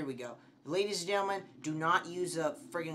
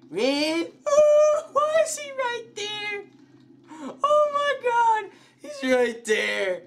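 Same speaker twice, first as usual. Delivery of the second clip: very high, loud shrieks of alarm; panicked screaming; 11 dB louder, slower than usual. Real, not acted. Player finds him right there, panicked screaming. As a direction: scream as if about to be caught.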